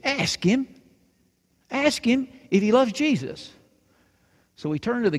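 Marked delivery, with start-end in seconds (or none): 0.0-3.5 put-on high-pitched little-girl insistence; insistent demand. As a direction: insist on it, voice jumping up. Girl insists after refusal.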